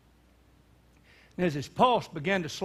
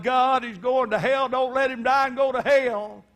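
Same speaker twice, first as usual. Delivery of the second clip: pleading prayer, higher and louder, breaking; crying, begging God; 7 semitones higher, 6 dB louder than usual. Retold or imitated. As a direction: beg God through tears for grandpa. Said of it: "began to cry and beg God".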